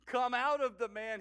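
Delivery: forceful, high-pitched rebuke; commanding order. A narrator's reading. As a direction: order a spirit out with full authority. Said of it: "he ordered".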